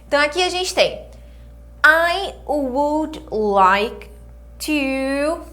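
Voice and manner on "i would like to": stretched out word by word, pitched up; teacher drawing out I would like to; very slow model phrase. Shown as a demonstration. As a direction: spell out each word slowly for learners. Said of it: "Então, olha só".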